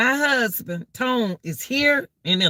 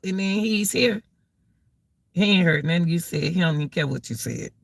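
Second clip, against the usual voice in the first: ordinary talk, nothing marked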